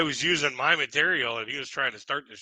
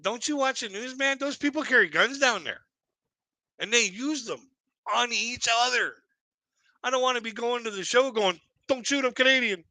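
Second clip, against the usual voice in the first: mock alarm; 9 semitones higher than usual. Comic to the club manager, high-pitched mock alarm.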